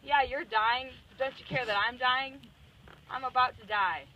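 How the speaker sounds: Jesus snapping back at the disciples, mock anger. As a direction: snap back at them, half-hearted. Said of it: "he snaps back at them".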